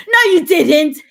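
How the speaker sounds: loud scolding denial; emphatic loud no. Real, not acted. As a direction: snap the answer back, hard. Scolding the listener.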